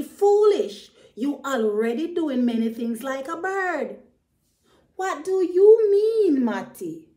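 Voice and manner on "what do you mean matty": surprised question. Acted. Ratty puzzled by Matty.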